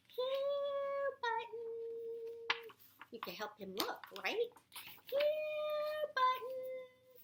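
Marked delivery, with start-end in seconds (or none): 0.1-2.7 high, loud calling out. Shown as a demonstration. Reader having the child call out, high and loud. 5.1-7.2 high, loud calling out. Acted. Reader having the child call out, high and loud.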